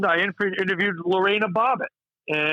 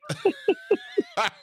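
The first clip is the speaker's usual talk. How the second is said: high laugh; 4 dB quieter than usual. Real, not acted. After the dummy's punchline.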